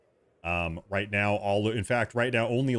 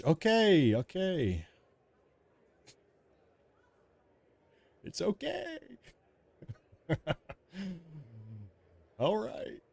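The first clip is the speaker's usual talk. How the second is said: high, softening placating voice; nervous placating; 8 semitones higher, 3 dB quieter than usual. Retold or imitated. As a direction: back down gently before an angry spouse. Placates the imagined angry wife.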